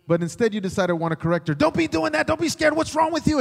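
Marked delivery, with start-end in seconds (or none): 1.5-3.4 much higher and louder, hammed-up scolding; preacher mimics husband scolding his scared wife, high and loud; mock correcting husband. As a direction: lecture her in a mocking squeaky tone.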